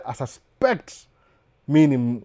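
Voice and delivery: normal talking voice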